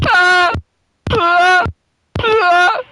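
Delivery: high piercing screams; screaming in panic. Real, not acted. Repeated screams in game.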